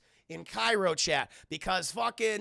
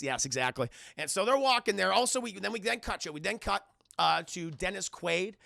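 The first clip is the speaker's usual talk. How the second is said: normal talking voice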